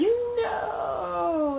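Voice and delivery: high wailing no, as if the egg failed; dismayed cry. Retold or imitated. Imagining a failed hatch, a high wailing cry. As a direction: cry out in mock despair. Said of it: "I'd be like".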